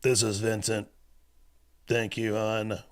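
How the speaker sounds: mock menacing man reading a text; weird put-on voice. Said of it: "trying to put on some kind of weird fucking voice".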